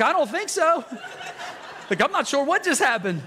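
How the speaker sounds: high, loud, laughing reply; dazed laughing answer. Retold or imitated. Preacher recalling his answer to his wife, laughing. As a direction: laugh through the stunned answer. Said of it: "I was like".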